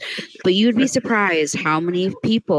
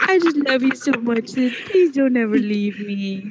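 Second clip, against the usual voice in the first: tender pleading; 6 semitones higher than usual. Retold or imitated. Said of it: "moments where you're like".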